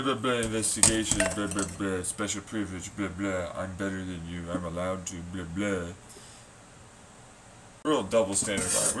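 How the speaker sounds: usual speaking voice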